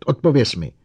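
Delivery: loud, high angry command; roared order. A narrator's reading. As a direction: demand an answer forcefully. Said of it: "zařval Bogert".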